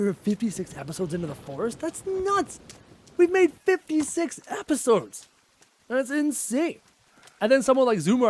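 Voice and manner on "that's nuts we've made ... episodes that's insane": high-pitched disbelief; amazed. Real, not acted. Marvelling to viewers at the episode count, amazed. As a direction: exclaim it high and drawn out.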